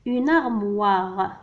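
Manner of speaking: armoire drawn out; slow teaching read. Shown as a demonstration. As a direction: model the word slowly.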